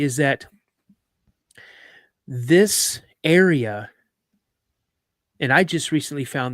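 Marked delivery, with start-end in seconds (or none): 2.3-3.9 slow suspense. Real, not acted. Building suspense before revelation.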